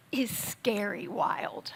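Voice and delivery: sudden slow whisper for effect. Real, not acted. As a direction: drop to a hushed whisper, slowly. Dramatic emphasis on God's power.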